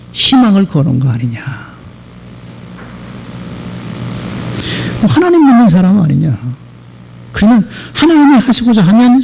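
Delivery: a hint of a kindly pastor, low and unhurried; gentle pastor's counsel. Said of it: "그 젊은 의사에게 그랬대".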